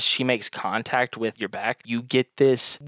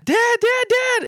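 put-on high, loud child cry for his dad; child screaming; 5 dB louder, slower than usual. A narrator's reading. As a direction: shout it like a little kid running to his father. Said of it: "and he's screaming".

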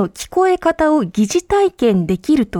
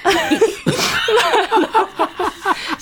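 embarrassed laugh; 7 semitones higher than usual. Real, not acted. Admits report failed.

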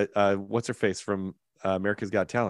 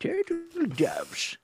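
very high, slow, drawn-out character voice; shop owner impression; slower than usual. Co-host joining in on the shop owner, high slow impression. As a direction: echo the film's shopkeeper, pitched way up and stretched. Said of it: "that actor had the greatest voice".

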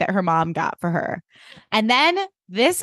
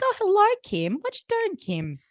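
sing-song Australian mum cadence; Australian accent, high then dropping on the name; Kath and Kim; 10 semitones higher, 4 dB quieter than usual. Retold or imitated. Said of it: "Like, their cadence".

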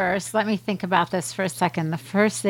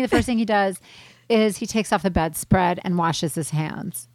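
normal talking voice

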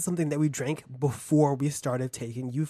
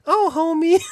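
Homer Simpson impression; 10 dB louder than usual. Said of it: "I can't even do it".

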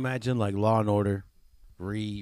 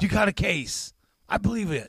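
high mock agreement; 9 semitones higher than usual. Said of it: "retelling reply".

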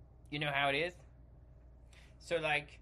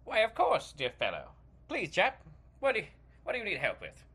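put-on British accent; 5 semitones higher than usual. British actor character.

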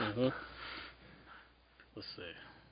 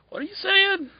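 much higher, baffled question; baffled squeak. Retold or imitated. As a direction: squeak out total confusion. Baffled listener, very high.

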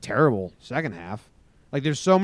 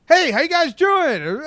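much higher, louder put-on radio voice; radio guest voice; 10 dB louder, slower than usual. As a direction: greet the listeners like a hammed-up radio guest. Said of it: "that's not how I talk".

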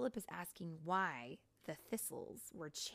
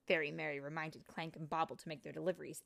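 plain reading voice

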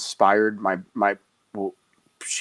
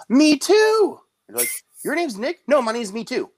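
playful clown gag. Acted.

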